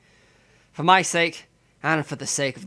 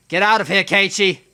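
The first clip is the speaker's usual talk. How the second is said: higher, louder urgent cry; desperate shout; 5 semitones higher, 7 dB louder than usual. Acted. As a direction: shout yourself into running. Hero urges himself to flee.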